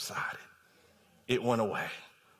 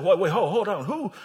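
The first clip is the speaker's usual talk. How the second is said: loud, indignant demand building then easing; preacher acting out royal anger; angry king; 9 dB louder than usual. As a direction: challenge them as an outraged king. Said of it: "And the king was angry then".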